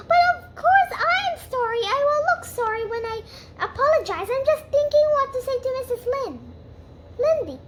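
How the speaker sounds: put-on high, far, excited girl voice; Anne's high excited chatter; eager girl voice. Anne chattering excitedly to Marilla.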